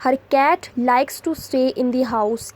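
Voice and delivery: very slow, each word set apart; slow dictation read. Shown as a demonstration. Teacher reading an example sentence to students, very slow. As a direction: spell it out slowly for the class.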